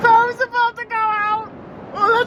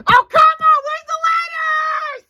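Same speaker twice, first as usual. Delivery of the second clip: high, loud protest; exasperated yell; 5 semitones higher, 5 dB louder than usual. Acted. Typing a town without letters, exasperated high yell. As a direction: cry out in frustration.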